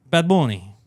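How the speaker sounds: ordinary delivery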